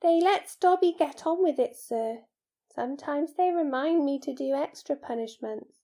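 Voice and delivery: high-pitched, obedient little servant; servile house-elf. Acted. House-elf tells Harry about his punishments, servile and earnest.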